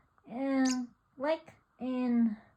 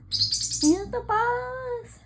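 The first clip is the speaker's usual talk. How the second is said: drawn-out high; 7 semitones higher, 4 dB louder, much slower than usual. Pretend. Owner teasing her bird, very slow high "boss".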